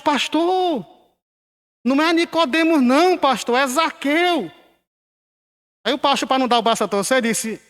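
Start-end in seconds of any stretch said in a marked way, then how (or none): 0.0-4.5 imitated worried correction. Worried church member corrects the pastor's mistaken name, imitated higher voice. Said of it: "alguém na igreja preocupado disse".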